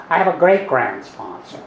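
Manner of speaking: ordinary talk, nothing marked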